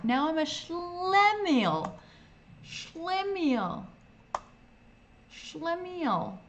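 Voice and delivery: high, slow mock Yiddish accent.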